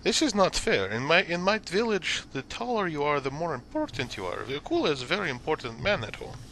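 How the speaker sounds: big swinging intonation, indignant; proud foreign fighter Akula. Acted. Puzzled fighter protests about height and importance, proud character voice.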